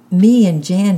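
high, loud little shout. A narrator's reading. Small boy clutching his sister, loud shout. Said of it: "shouted Francis".

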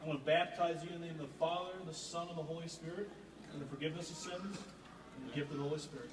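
formal, slightly quick ritual pronouncement; baptism formula. Real, not acted. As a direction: pronounce the blessing like the officiant.

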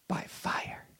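hushed, breathy whisper; whispered pulpit aside. Acted. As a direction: drop to a whisper for effect. Dramatic sermon climax.

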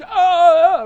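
loud, high mock yell of panic; mock crowd scream. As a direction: imitate a panicked mob screaming "ah". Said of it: "they're just yelling and screaming".

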